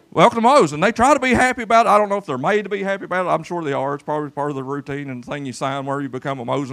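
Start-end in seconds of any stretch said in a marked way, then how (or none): 0.1-0.7 loud bright restaurant welcome; cheery greeting. Shown as a demonstration. Pastor showing how Moe's staff greet, cheery and loud. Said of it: "What do they say?".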